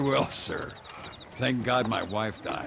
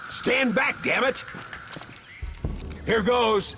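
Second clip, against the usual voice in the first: urgent warning shout; 11 semitones higher, slower than usual. Acted. Cowboy warns his partner before blowing the door, urgent shout. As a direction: warn them before the blast.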